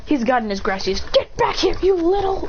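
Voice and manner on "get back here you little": mock angry yell. Boruto chasing the escaping carp, high mock angry yell. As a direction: yell after the fish in frustration.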